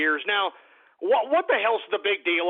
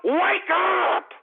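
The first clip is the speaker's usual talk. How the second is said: higher, drawn-out "wake up"; exasperated yell; 7 semitones higher than usual. Real, not acted. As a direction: yell it to rouse the listeners. Exasperated plea to listeners.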